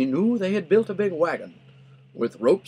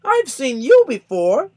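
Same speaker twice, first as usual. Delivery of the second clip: much higher, louder accusing bird voice; surprised bird; 11 semitones higher, 8 dB louder than usual. A narrator's reading. Maisie spotting Horton in the tent, high and loud. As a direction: point him out in shock.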